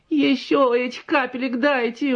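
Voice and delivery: high, slow wheedling plea; sweetly begging. A narrator's reading. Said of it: "умильно попросила баба".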